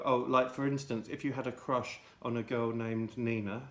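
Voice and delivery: ordinary talk, nothing marked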